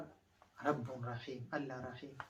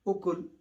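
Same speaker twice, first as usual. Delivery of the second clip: أكل said higher and louder; stressed word; 4 semitones higher, 7 dB louder than usual. Shown as a demonstration. Teacher stresses the Pashto-style word, emphatic. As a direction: hit أكل hard. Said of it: "نحن نقول".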